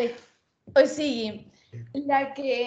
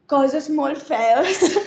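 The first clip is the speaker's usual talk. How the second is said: slow, drawn-out words through laughter; laughing quote; 3 semitones higher, much slower than usual. Retold or imitated. Quoting the drunk friend's insult, laughing and slow. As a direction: stretch it out while cracking up.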